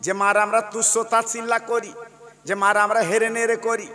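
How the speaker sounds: chanted sermon, rising and loud; fervent Bengali sermon cadence; impassioned waz preaching. Acted. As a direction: preach it with full force to the crowd.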